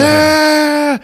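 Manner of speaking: high mock scream of pain; retold scream. Friend hit by acid yelping in a high mock scream. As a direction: yelp like acid just hit you.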